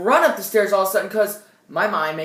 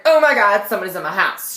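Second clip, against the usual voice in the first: retold freaking out; 7 dB louder, faster than usual. Teen texting a friend about an intruder, acted freak-out. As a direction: blurt it out in acted alarm. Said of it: "freaking out right now".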